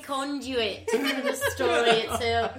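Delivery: hammed-up British accent, laughing; pompous mock-British storyteller breaking into laughs. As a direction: put on a grand British voice. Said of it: "speaks exclusively in British accents".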